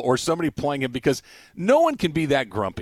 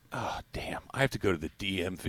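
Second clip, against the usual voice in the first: muttered dismay; 6 semitones lower, 11 dB quieter than usual. Acted. Acting out losing a wallet.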